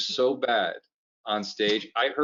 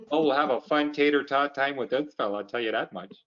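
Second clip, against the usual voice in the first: put-on folksy Minnesota accent, softening at the end. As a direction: do a cheery Minnesotan. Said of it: "Why don't you start in Minnesota".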